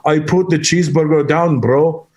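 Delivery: bodybuilder's imitated European accent; imitated foreign accent. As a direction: deliver it deadpan in a mock accent. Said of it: "he has an accent".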